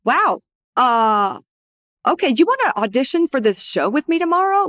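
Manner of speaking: a startled laugh in the voice, then blurt the invite fast; quoting Joe, bemused, then blurting the invitation. Retold or imitated. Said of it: "he was like".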